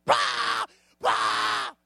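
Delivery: sudden high, loud "bah" scream; demonstrated scream. Shown as a demonstration. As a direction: scream a short blast right at them. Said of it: "scream at you like Bryce".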